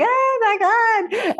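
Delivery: high, slow gushing "Oh my God"; mock gushing. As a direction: gush it out high and slow like an adoring fan. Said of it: "people are like".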